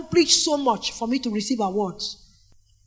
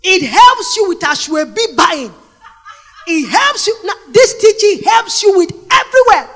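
raised, driving preacher voice; fervent preaching; 7 semitones higher, 8 dB louder than usual. Acted. Preacher urging the women about gatherings. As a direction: push it out to the crowd.

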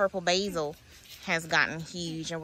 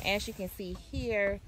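normal talking voice

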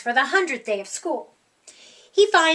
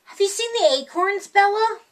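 worried question; 6 semitones higher, faster than usual. Acted. Rocket anxiously asking about acorns.

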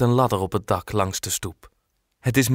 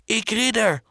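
much higher, lightly voiced small boy; eager call; 11 semitones higher than usual. Little boy claiming to be a knight, high eager call. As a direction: pipe it out proudly. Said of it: "roept Dirk".

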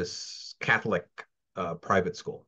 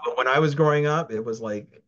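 normal talking voice

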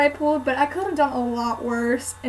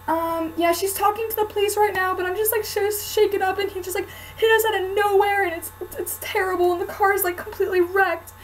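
higher, halting, faking being shaken up; fake panic; 7 semitones higher than usual. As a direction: stammer out the fake crash story. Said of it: "Freaking out on her".

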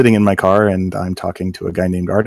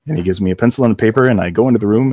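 usual speaking voice

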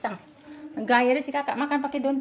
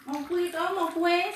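coaxing pet; 6 semitones higher, slower than usual. Real, not acted. Offering cake to cat.

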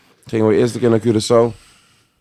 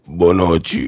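imitating heavy voice; 6 semitones lower, 5 dB louder, slower than usual. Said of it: "die man zegt weer".

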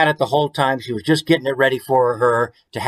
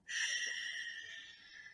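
whispered shh; shushing; 25 dB quieter than usual. Acted. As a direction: hush them with a soft shh. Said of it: "is saying".

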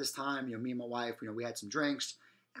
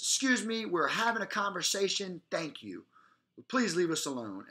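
high, loud, cold brush-off; imitating girls telling a stranger to go away; curt brush-off; 5 semitones higher, 4 dB louder than usual. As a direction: shut him down sharply. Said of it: "these girls shut me down".

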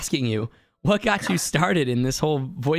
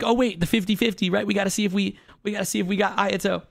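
raised pitch, still keyed up and rushing; eager hope; 8 semitones higher, faster than usual. Retold or imitated. Streamer hoping the pull is Ayato, eager rush. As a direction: hurry toward the fifty fifty check. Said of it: "freaking out".

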